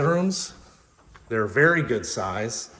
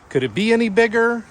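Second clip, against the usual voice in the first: high, fast and loud, mock amazed; excited rhetorical question; 12 semitones higher, 6 dB louder, faster than usual. Realtor asking viewers, mock amazed, high and fast. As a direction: pitch it way up like you can't believe it.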